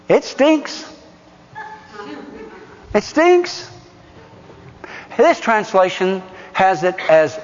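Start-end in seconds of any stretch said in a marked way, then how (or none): 0.1-0.9 teasing reveal. Shown as a demonstration. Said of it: "It's a four-letter word". 2.9-3.7 teasing reveal. Shown as a demonstration. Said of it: "It's a four-letter word".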